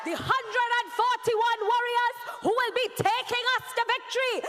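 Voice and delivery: high, loud crowd-hyping shout; rally speech. Real, not acted. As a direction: fire up the crowd to roar.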